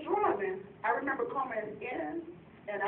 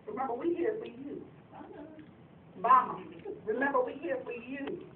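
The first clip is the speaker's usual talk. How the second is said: ordinary talk, nothing marked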